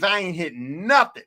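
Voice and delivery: ordinary talk, nothing marked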